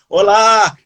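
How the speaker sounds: olá with a long stretched final a; stretched olá demo; drawn-out olá. Shown as a demonstration. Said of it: "ele colocava vários A's".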